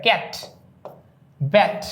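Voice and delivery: ক্যাট and ব্যাট said singly, vowel wide open. Shown as a demonstration. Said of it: "এইরকম অনেকটা উচ্চারণ হয়".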